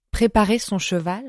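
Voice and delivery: ordinary delivery, nothing marked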